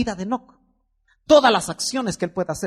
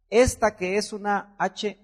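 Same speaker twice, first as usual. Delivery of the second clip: ordinary delivery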